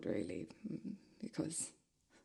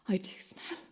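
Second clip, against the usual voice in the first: whispered aside; 5 dB quieter than usual. Acted. Admitting he smells.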